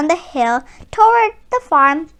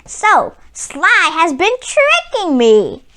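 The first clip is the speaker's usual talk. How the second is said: growled; 3 semitones higher, slower than usual. Acted. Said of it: "growled Barkus".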